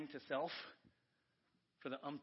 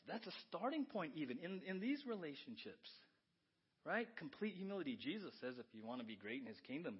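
usual speaking voice

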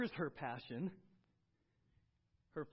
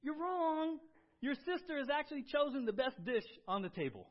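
emphatic rebuke; 8 semitones higher, 7 dB louder than usual. Retold or imitated. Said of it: "He says".

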